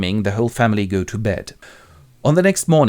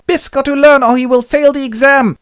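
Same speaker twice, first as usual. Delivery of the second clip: very high, loud little nerd; Bernard; 8 dB louder than usual. Acted. Little neighbor warns Willie about Biff's exam, nerdy alarm. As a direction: warn like a fretful swot, pitched way up. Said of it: "little nerd, a Bernard from next door".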